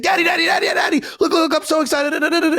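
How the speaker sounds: put-on be a six-year-old who can't wait to show dad; excited little kid. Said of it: "He's like".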